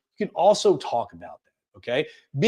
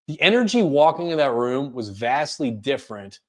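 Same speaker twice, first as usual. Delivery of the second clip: ordinary delivery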